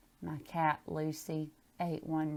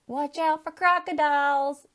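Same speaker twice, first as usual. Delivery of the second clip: loud, higher playful call across the creek; calling back; 9 semitones higher, 9 dB louder than usual. Acted. Teacher calls to the girl on the rocks, playful shout. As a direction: call out teasingly from afar. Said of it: "you called back".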